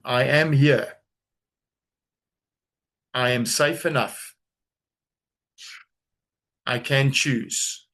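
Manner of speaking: slow, measured words with long pauses; unhurried mantra, phrase by phrase; slow affirmation. Shown as a demonstration. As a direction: say it slowly for others to repeat. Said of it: "say this slowly".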